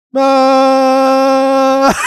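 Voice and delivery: one long high loud note; held note. Retold or imitated. Daughter waking parents, one long held note. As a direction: hold the Ma as long as you can. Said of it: "Just holding one note as long as she can".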